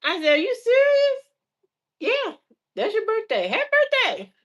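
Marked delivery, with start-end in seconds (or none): 0.3-1.2 very high incredulous question; stunned at the gift; disbelief. Retold or imitated. Her disbelief at dad. 2.0-4.3 high, put-on dad voice; mocking daddy voice. Dad voice wishing happy birthday.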